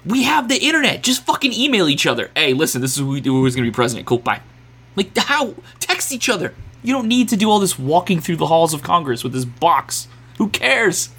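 high, exasperated disbelief; exasperated rant. Real, not acted. Exasperated rant about long process.

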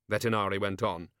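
ordinary delivery

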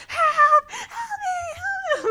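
very high, drawn-out mock cry for help; hammed-up distressed wail, squealing; Shay. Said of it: "we just hear Shay going".

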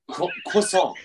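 croissant with a w sound, "kwah-sawn"; changed pronunciation. Shown as a demonstration. Getting friends to notice the difference, croissant as "kwah-sawn". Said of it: "You're going from croissant to croissant".

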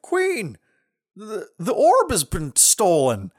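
high, stammered henchman's report; mock alarm. Henchman bursting in on the queen, mock alarm. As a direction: imitate a panicked messenger, slowing on the news.

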